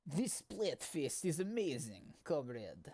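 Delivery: slow, lightly put-on Russian accent; a quick half-hearted Russian accent on request; a jokey Russian accent for a friend. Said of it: "Can you do a Russian accent?".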